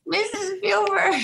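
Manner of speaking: put-on high, slow frail old lady voice; old woman voice. To Spielberg, pretending to be an old fan: high, slow old lady voice. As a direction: play a doddering old woman, drawn out. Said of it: "old woman in wheelchair".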